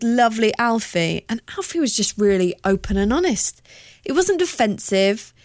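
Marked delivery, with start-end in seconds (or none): none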